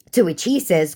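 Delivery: ordinary delivery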